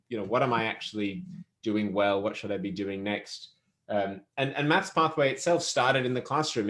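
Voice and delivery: ordinary delivery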